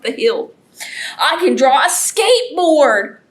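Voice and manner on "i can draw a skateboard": plain reading voice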